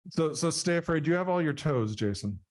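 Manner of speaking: usual speaking voice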